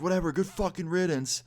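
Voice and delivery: high-pitched yell at held-back volume; mock yell. Host yelling after guests leaving the tent, mock yell. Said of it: "he just yells".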